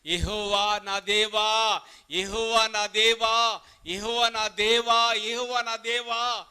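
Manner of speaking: slow, loud, drawn-out cry to God; fervent prayer. Retold or imitated. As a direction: plead slowly and loudly to the Lord. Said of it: "ప్రార్థిస్తున్నాడు". (ఏలీయా గట్టిగా నెమ్మదిగా ప్రార్థన)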